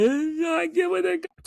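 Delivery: very high, drawn-out squeal; mock emotional outburst. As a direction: squeal it slowly, far above your voice. Acts out emotional movie moment.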